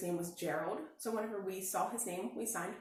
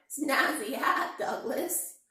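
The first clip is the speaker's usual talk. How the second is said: slow, louder bleating compliment; sheep bawling; 4 semitones higher, 7 dB louder, much slower than usual. A narrator's reading. Sheep praising Douglas's hat, slow and loud. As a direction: drag it out like a sheep. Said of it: "bawled the sheep".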